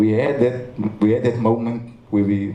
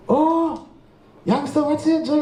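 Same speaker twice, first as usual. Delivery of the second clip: a higher, cheery Cape Flats greeting; imitating a Cape Flats hello, pitched up; light Cape Flats accent, higher. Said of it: "Benny still have the accent".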